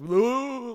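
high, loud "ooh" as the car veers; imitated swerving whoop. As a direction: let out a sudden rising cliff-edge whoop. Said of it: "like this kind of shit".

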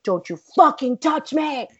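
high-pitched furious retold warning; angry warning. Actress to man touching her thigh, angry warning. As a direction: warn him off with heat.

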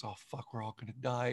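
soft quick fatalistic aside; deadpan mutter. Retold or imitated. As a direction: mutter the doom flatly. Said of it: "Idris Elba goes".

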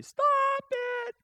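high shout to stop it. Retold or imitated. Man on the Ferris wheel yelling to the operator, high shout.

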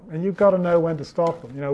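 ordinary talk, nothing marked

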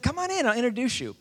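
high, quick, loud invitation; calling him in. Retold or imitated. Buddy urging him into the bar, quick high call. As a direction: holler an eager invite.

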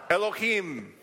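"Elohim" said as "e-lo-HEEM"; Hebrew word. Shown as a demonstration. Leading the crowd to repeat "Elohim". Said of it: "Say, Bereshit".

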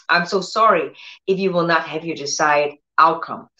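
usual speaking voice

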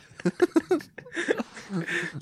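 high squealing laugh; laughing hard; high laughter. Real, not acted. Cracking up.

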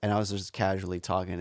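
ordinary talk, nothing marked

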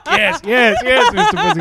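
high, loud hammed-up moans of pleasure; mock moaning woman.

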